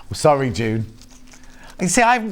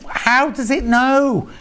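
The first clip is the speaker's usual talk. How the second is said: amazed; 9 semitones higher, much slower than usual. Retold or imitated. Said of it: "And you're like".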